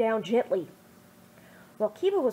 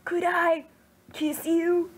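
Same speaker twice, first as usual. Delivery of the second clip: raised, hesitant, hopeful question; Kiba asking shyly; 7 semitones higher, slower than usual. Acted. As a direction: ask it nervously, holding your breath. Kiba asks for a kiss.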